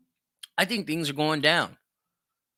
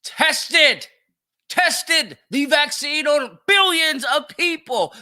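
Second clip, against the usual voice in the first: loud, high incredulous repeat, slowing on the second line; outraged mocking shout of the clip's words; mocking echo; 10 semitones higher, 6 dB louder, slower than usual. Real, not acted. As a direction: throw his words back at him in scorn. Mocking echo of Obama's clip.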